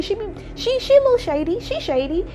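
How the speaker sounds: high-pitched, teasing aside. Real, not acted. Admitting the doctor is shady, teasing high voice.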